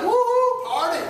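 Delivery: high whoop with a laugh; whooping party. Retold or imitated. Israelites scooping up loot, whooping with joy. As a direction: celebrate like you're free.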